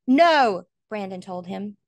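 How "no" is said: higher, louder refusal; desperate no. Acted. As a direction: refuse with all your heart. Son refuses father's farewell.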